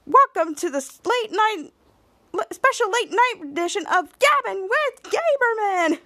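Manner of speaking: high, sing-out show welcome; talk show host. Retold or imitated. Mary opens her late night edition, gushing host.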